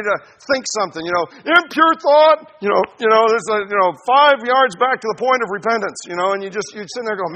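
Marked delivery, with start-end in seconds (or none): 1.4-2.6 slow, loud, much higher official's announcement; referee call. Acted. Preacher voicing the heart's referee for the saints, booming call. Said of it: "the referee that's inside of my heart". 3.3-6.0 loud, raised penalty announcement; referee call. Acted. Preacher voicing the heart's referee marking the penalty, loud. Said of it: "the referee that's inside of my heart".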